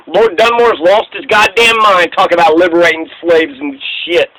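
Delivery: usual speaking voice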